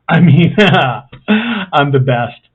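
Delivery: loud laughing boast; hammed-up self-praise; mock bragging. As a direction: crow about it playfully.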